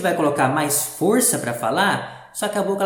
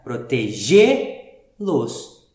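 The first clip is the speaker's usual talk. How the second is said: "protegê-los" very slow, GÊ held with force; stress on accented syllable; 4 semitones lower, 3 dB louder, much slower than usual. Shown as a demonstration. Teacher recapping the circumflex, stressed accented syllable. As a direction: stretch and push the "gê" of "protegê-los". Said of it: "com um pouco mais de força".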